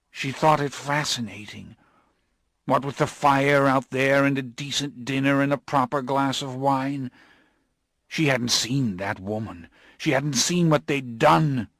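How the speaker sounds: desperate telling of his wife's fate; desperately. Acted. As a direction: plead the memory desperately. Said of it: "he said desperately".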